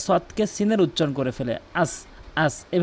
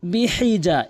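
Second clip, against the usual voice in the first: usual speaking voice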